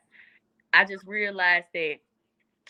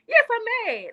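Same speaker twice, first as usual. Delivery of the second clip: defiant yes; 14 semitones higher than usual. Pretend. Answers the taunt defiantly.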